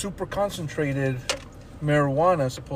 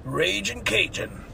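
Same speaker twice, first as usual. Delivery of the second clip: fried chicken mascot; 5 semitones higher than usual. Acted. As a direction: greet them like a restaurant mascot. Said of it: "That sounds like some, uh, uh, a fried chicken place".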